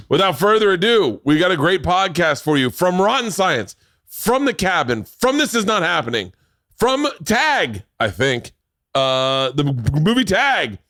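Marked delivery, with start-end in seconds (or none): 0.1-7.8 high, hyped-up emcee intro; big playful introduction, pitched way up; show announcer. Pretend. Announcing the podcast to the audience. 10.0-10.8 high, hyped-up emcee call; pitched-up introduction; show announcer. Pretend. Announcing the guests' credits.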